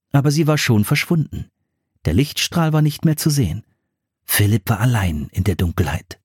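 plain reading voice

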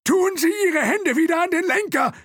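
very high, loud frantic order; shrieked command. Acted. Thompson ordering the driver, shrieking the command. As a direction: order it at the top of your voice. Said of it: "befahl Thompson".

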